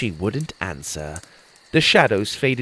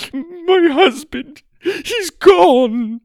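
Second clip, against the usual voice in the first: much higher, louder slow wail; distraught Ma; 8 dB louder, much slower than usual. A narrator's reading. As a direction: let grief stretch the words. Distraught Ma answering.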